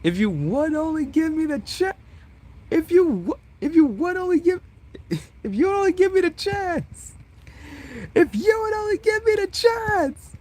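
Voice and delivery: very high, wailing, breaking mock sob; melodramatic crying plea. Vessel begging Bug for a chance, mock sobbing. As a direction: wail it like a soap-opera heartbreak.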